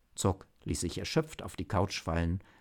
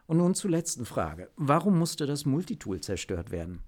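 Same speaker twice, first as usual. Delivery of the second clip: higher-pitched businesslike chief; official chief; 6 semitones higher, 4 dB louder than usual. Acted. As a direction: speak as the chief issuing a formal statement. (sachliche Chefin, höhere Stimme)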